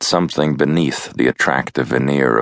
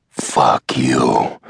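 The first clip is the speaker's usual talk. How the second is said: plain reading voice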